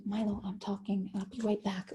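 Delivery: quiet aside to her son, off-mic; turning away from phone. Real, not acted. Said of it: "That was my son".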